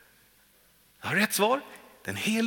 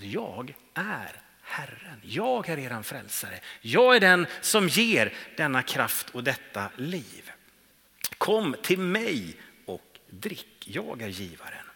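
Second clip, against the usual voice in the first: low, soft and unhurried invitation; gentle voice of Jesus; 8 semitones lower, 5 dB quieter than usual. Acted. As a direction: speak it softly, as a tender offer. Preacher voicing Jesus' invitation. (preacher voicing Jesus softly: mild, tender invitation)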